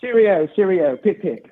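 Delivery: hammed-up English cheerio; cartoonish put-on English accent. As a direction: toss out stock English sayings in a mock accent. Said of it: "this is my character for the night".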